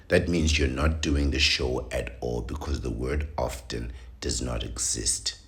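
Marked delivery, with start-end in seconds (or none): none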